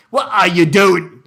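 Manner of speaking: put-on loud, botched Irish accent; attempted Irish accent. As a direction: have a poor go at Irish. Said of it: "I can't do Irish".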